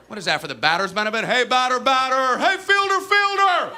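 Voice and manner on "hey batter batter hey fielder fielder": loud chant, far higher on FIELDER; mock call-and-answer between batter chant and higher echo; infield chatter. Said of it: "Chattering is saying".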